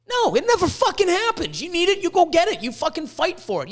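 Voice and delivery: high, loud, forceful burst; pep talk at full push; emphatic rebuttal. Real, not acted. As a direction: fire back with fierce insistence, high-pitched. Rebuts waiting for rescue.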